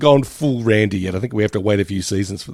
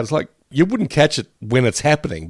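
ordinary talk, nothing marked

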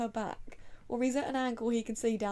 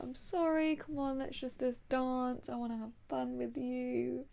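apologetic; 4 semitones higher than usual. Acted. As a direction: coax gently, lightly acting it out. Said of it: "he's so apologetic".

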